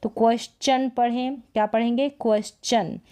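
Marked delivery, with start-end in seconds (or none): 0.1-0.9 क्वेश्चन as "kwes-chan"; T read as CH. Shown as a demonstration. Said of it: "च का sound देगा". 2.1-3.0 क्वेश्चन as "kwes-chan", falling; T read as CH. Shown as a demonstration. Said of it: "च का sound देगा".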